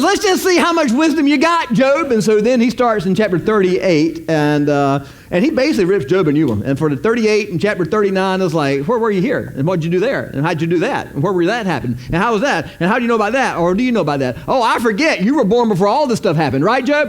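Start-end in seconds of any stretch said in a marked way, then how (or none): 0.0-2.1 high, loud taunting challenge; mocking God. God taunting Job about his wisdom, high and loud. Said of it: "he says". 8.9-17.1 higher, rapid-fire mocking questions; sarcastic God. God grilling Job with sarcasm, higher. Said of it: "God even used some sarcasm".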